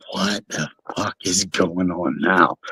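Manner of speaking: half-whispered worry at the door; hushed alarm. Retold or imitated. Hearing a knock at his hotel door, low hushed alarm. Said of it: "and I'm like".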